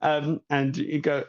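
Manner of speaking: usual speaking voice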